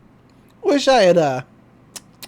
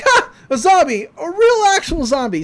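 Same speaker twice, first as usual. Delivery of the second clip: excited; 9 semitones higher, 5 dB louder than usual. Acted. Dipper shows off zombie.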